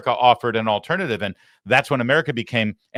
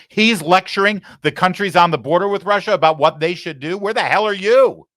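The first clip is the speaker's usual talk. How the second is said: louder, higher furious yell; ranting shout; 10 semitones higher, 8 dB louder than usual. Real, not acted. Host shouting at a former president, furious. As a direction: build to a yell on the last line.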